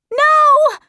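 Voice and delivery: put-on very high, loud girl's scream. A narrator's reading. Meg seeing her brother changed, high horrified scream. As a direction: scream it out in horror. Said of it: "screamed Meg again".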